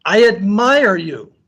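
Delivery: high, drawn-out call; screamed praise. A narrator's reading. Father screaming to the mountains, admiring holler. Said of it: "he screams to the mountains".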